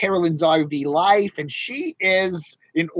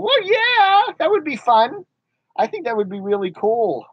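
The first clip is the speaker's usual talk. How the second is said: much higher and louder excited puppet reply; 9 semitones higher, 4 dB louder than usual. Acted. Puppet answering a viewer, excited and high. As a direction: squeal it like an eager puppet.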